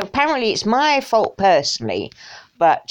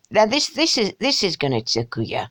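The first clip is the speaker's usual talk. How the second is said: usual speaking voice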